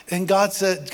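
ordinary delivery

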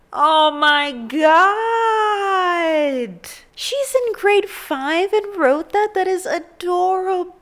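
high, loud, slow half-crying wail; emotional high-pitched crying squeal; tearful squeal. Real, not acted. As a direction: cry out, overwhelmed. Overcome by letter.

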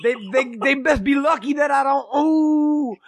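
high, stuttering mock threat; hammed-up bluster ending in a moan; Jesse. Fired friend holding himself back, high comic fuming. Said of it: "you were like".

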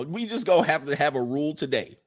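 normal talking voice

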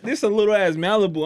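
ordinary talk, nothing marked

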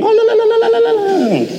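very high rapid ululation; ululating. Retold or imitated. Saleh scaring a woman off her seat, ululating. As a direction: trill la-la-la at the top of your voice. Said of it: "he starts ululating".

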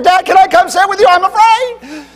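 put-on a small boy yelling down the stairs in a panic; frightened child hollering. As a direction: kid pleading from the top of the stairs, high and loud.

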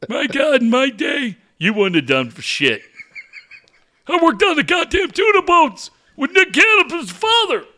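put-on high, loud feisty old man; old caller boasting. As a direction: brag like a wound-up old-timer.

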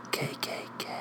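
very soft whisper; hushed, sneaky aside; whispered joke. Acted. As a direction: whisper each letter under your breath. Hushed aside after edgy joke.

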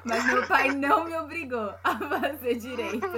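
laughing through the words. Real, not acted. Woman laughing as she compares her story. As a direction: let the laugh spill over the sentence.

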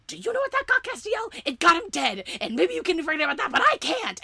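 hammed-up furious yell, pitch way up; imitating Dean screaming. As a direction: shriek it like an unhinged brother. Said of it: "the way that he is screaming".

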